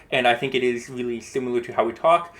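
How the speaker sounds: ordinary talk, nothing marked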